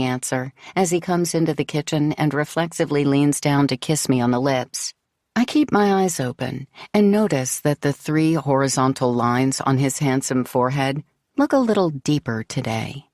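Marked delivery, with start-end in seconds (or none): none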